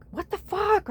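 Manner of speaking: mock outrage. Vlogger mimicking old self getting mad at things, mock outrage. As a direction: act out a high, exasperated outburst. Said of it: "I'd get so mad".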